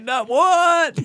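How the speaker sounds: very high, drawn-out mock shock; shocked disbelief. Drunk man learns it's the women's bathroom, shocked. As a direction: squeal it high in acted disbelief.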